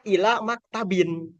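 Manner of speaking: slow ILA MAKTABIN, ending in -bin; tanwin ending. Shown as a demonstration. Teacher showing reading without alif lam, tanwin ending. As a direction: sound the final n on "maktabin". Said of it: "maka tanwinkan ila maktabin".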